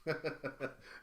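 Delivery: short happy laugh; quick pleased chuckle; delighted laugh. Real, not acted. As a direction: laugh at the good news. Laughing after the reveal.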